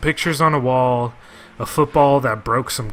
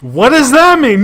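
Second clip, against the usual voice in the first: loud, high, baffled yell; exasperated shout; 12 semitones higher, 11 dB louder than usual. Real, not acted. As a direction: shout it at the screen in disbelief. Repeats the question, exasperated.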